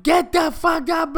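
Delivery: high, loud drawn-out roar; hammed-up furious shout; leader yelling. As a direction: bellow it down the phone in a mock rage. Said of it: "why are you yelling".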